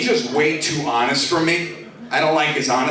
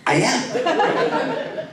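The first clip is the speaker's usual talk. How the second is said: strained high squeak; 10 semitones higher than usual. Retold or imitated. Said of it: "And I'm like".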